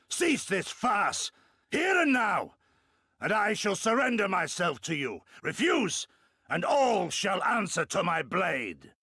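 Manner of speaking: proud shouted ultimatum; defiant ultimatum. Acted. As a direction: lay down terms like a warrior. Warrior defies viceroy, offers surrender.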